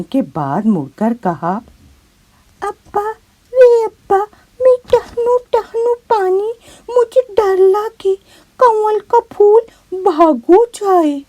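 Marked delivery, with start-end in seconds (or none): 2.6-11.2 put-on high, tearful little girl's wail; a scared child crying out to her father from the water; high crying girl's voice, fear rising; frightened crying child. Scared girl calls father.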